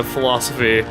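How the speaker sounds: ordinary delivery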